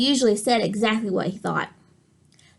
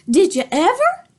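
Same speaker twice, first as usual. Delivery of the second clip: higher, louder astonished grandma; gasping; 6 semitones higher, 5 dB louder than usual. Acted. Grandma in the diner sees the duck, astonished gasp. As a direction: gasp it out in shock. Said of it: "gasped his grandma".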